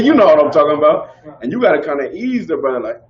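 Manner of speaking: ordinary delivery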